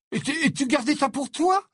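high, loud astonished outburst; astonished exclamation. Acted. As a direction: blurt it out in shock. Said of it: "s'exclama Ron".

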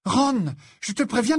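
high, loud, urgent warning; alarmed. A narrator's reading. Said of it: "répéta Hermione alarmée".

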